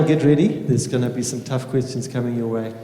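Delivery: ordinary talk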